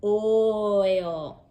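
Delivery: oil said slowly, drawn out; oil without the k, held; deleted sound. Shown as a demonstration. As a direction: say oil with the k dropped. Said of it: "throw the k".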